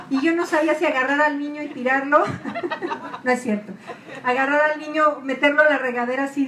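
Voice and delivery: laughing through the words; giggling while she tells the joke. Real, not acted. Laughing while telling the church about bath time. Laughing at own baby mishaps.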